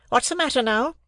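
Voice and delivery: sharp sister's voice; sister, said smartly. A narrator's reading. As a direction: snap the question at the boy. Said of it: "she said smartly".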